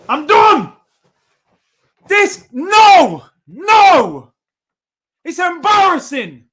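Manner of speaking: loud, high despairing no; exasperated yell. Real, not acted. Fan raging at Bellerin's foul throw, exasperated yell. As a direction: give up on him, shouting.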